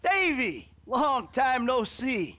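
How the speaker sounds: surprised greeting. Acted. Surprised greeting to old friend.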